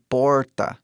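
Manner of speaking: "porta" with a retroflex, English-like R; thick R. Shown as a demonstration. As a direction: curl the tongue on the R of "porta". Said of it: "esse R grosso assim".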